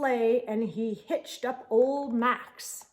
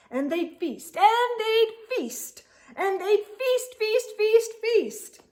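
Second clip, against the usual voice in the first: high, louder, sneering repetition; mock each feast with disgust; 10 semitones higher, 4 dB louder than usual. Grinch mocks the feasting.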